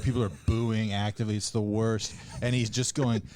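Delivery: normal talking voice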